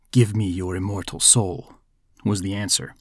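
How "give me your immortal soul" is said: low, soft devil voice; hushed, deep demand from the devil; devil's demand. A narrator's reading. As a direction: drop low and quiet to claim a soul.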